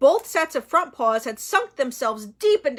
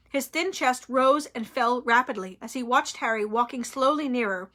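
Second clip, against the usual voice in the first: plain reading voice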